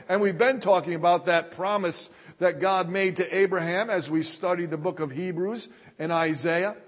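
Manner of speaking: usual speaking voice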